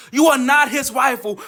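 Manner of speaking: ordinary talk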